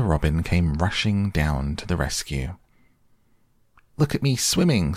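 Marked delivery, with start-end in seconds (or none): 4.0-5.0 much higher squeaky little one; squeaky Roo. Acted. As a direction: squeak it with glee. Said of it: "squeaked Roo".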